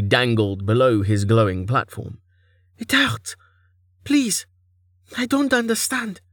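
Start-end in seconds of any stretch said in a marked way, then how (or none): 2.8-6.2 very high, small, frightened voice; helpless, high-pitched begging; pained pleading. Acted. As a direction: plead weakly through pain. Hurt captive pleads with god.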